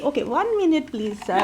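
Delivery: usual speaking voice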